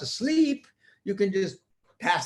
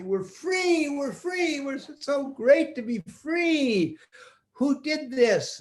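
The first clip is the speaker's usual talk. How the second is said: joyful; 10 semitones higher, slower than usual. Acted. Freed people rejoice.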